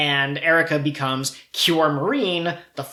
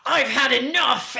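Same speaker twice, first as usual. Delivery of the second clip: lightly raised heroine voice; a hint of a righteous magical girl; heroic speech; 8 semitones higher than usual. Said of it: "Tsubomi will then be like".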